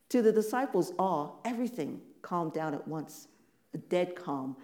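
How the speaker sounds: ordinary talk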